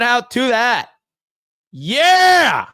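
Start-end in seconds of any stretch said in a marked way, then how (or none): 1.7-2.7 joyful shout. Real, not acted. Celebrating the win.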